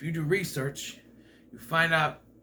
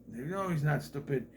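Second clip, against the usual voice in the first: ordinary talk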